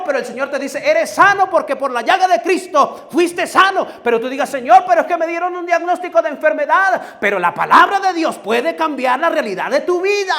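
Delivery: high, loud, fervent preaching; preaching at full force. Acted. Preacher lifting the congregation with promises, fervent full-force preaching. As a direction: preach it to the rafters.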